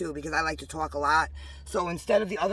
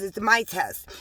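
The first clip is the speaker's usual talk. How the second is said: ordinary delivery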